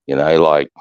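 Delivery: usual speaking voice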